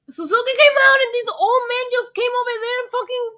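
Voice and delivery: high, loud excited telling. Real, not acted. Fan telling viewers about Suzuki's attack, thrilled and loud. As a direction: gush about the surprise.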